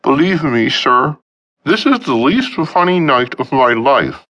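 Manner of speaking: high, earnest pleading; sincere. A narrator's reading. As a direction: beg him to believe, voice up high. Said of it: "he said sincerely".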